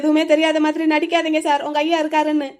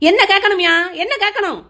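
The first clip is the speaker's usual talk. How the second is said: high-pitched, exasperated challenge; loud start, indignant high pitch; angry retort; 4 semitones higher, 3 dB louder than usual. Acted. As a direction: throw the question back angrily. Arguing back at grandfather.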